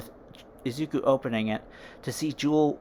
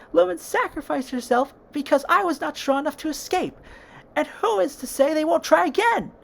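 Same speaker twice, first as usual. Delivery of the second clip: much higher and louder, near tears and shouting; distraught outburst; 13 semitones higher, 4 dB louder than usual. Acted. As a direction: cry out in despair at him.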